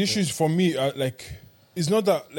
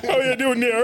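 laughing sing-song Cork accent; 7 semitones higher than usual. Shown as a demonstration. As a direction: Cork lilt, giggling through it. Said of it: "give me your best Cork accent".

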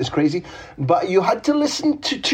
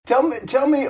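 higher, eager tell me, tell me; eager prodding; 9 semitones higher than usual. Real, not acted. Host pressing his guest to answer, eager and higher. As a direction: coax the answer out of him keenly.

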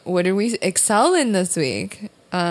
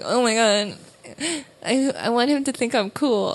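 higher, lightly mocking own nervous thoughts; starstruck nerves; 5 semitones higher than usual. Comedian recalling being scared of Ivan, mock nervous. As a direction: let a laugh creep in at the end. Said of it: "I was also scared of talking to Ivan".

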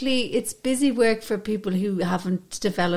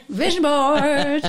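louder, drawn-out mocking cheer; mocking the women who love vision boards; mock exclamation; 4 semitones higher, 5 dB louder, slower than usual. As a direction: announce it like a punchline.

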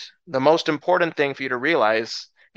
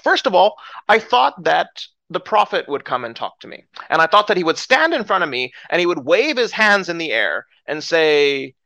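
angry Naaman; 4 semitones higher than usual. Acted. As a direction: play the affronted man demanding respect. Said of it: "he gets up extremely angry".

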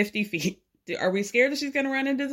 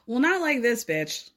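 ordinary talk

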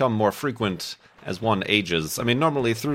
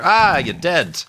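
gloating shout; 7 semitones higher, 6 dB louder, slower than usual. Real, not acted. Gloating after killing skeleton.

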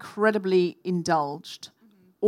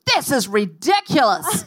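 angry; 6 semitones higher, 4 dB louder, slower than usual. Retold or imitated. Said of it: "she got so angry".

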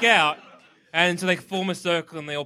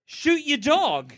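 high, slow, drawn-out command; slow deadpan order; slow mock order; 6 semitones higher, much slower than usual. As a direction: spell out the order slowly. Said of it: "they're like".

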